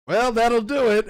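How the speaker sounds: a deadpan dying remark, voice lifted; mock dying king; dying Goblin King. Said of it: "he's like".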